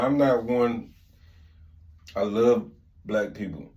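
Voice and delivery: ordinary delivery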